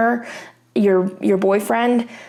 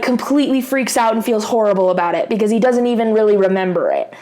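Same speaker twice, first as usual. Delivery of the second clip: ordinary talk, nothing marked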